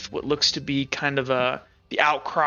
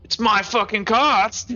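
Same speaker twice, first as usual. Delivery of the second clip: indignant trader; 6 semitones higher, 4 dB louder, slower than usual. Acted. Angry trader claims his cart.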